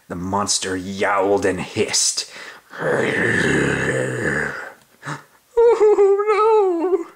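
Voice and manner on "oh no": sobbing. Acted. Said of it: "sobbed the dogs".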